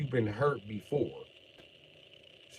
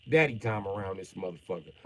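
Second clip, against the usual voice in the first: normal talking voice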